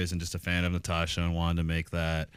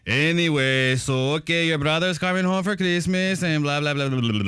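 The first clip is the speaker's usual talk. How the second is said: mock dad moving on; 9 semitones higher, 5 dB louder than usual. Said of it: "He says".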